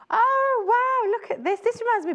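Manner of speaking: high, loud delighted gasp of wow; real delight, pitched high and loud; excited. Real, not acted. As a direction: burst out in delight at the fabric. Delighted at new fabric.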